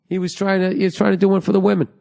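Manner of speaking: ordinary talk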